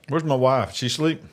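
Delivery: usual speaking voice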